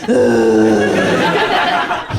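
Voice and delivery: very low, loud growled ugh; deep growl. Retold or imitated. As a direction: growl it deep like the alpha dog. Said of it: "I go".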